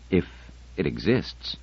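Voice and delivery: a lightly hoarse doubting voice. Acted. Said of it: "said Fisher hoarsely".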